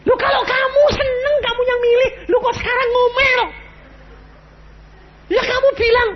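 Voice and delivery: high, loud rebuke, close to crying; preaching shout. Real, not acted. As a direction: shout them down from the pulpit. (teriakan khotbah yang keras dan tinggi)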